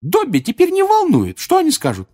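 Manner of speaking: loud, high elf retort; squeaky house-elf standing up boldly; defiant Dobby. A narrator's reading. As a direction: throw it back with a challenge. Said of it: "с вызовом произнёс Добби".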